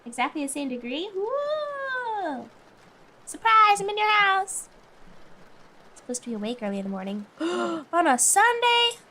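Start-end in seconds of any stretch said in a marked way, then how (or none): 1.2-4.7 loud, high playful shout; spring a joke surprise on them; mock surprise. Streamer teasing chat, loud high mock surprise. 7.4-9.0 gasping, slow, high outrage; mock shock. Streamer gasping at waking early on Sunday, mock shock.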